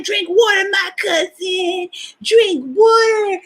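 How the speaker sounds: high, slow, drawn-out nagging; cousin's urging. Retold or imitated. Cousin Sonia urging me to drink water, high and slow. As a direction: stretch it out high like a pushy cousin. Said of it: "she's like".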